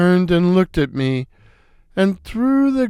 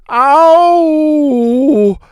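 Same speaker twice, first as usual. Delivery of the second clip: much higher, louder wail; hammed-up cry of pain; 9 semitones higher, 5 dB louder, much slower than usual. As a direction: wail like you're losing it. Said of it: "I cried".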